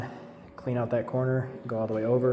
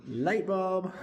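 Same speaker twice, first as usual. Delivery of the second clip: much higher, playful repeat of the idea; eureka echo; 9 semitones higher than usual. Acted. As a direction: echo it up high, tickled by the idea.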